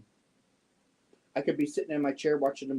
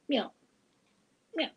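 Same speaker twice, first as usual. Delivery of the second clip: imitated meow. Meowing at the cat.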